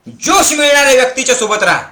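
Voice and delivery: fast, high and loud; energetic, loud preaching; rousing exhortation. Acted. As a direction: fire the crowd up. Rousing sermon climax.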